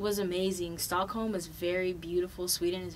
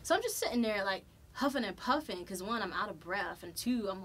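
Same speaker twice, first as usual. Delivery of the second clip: normal talking voice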